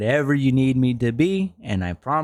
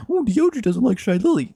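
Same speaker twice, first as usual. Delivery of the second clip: high, loud sneering mimicry of critics; mock the people accusing him, in a high loud sneer; mocking whine; 10 semitones higher, 4 dB louder, faster than usual.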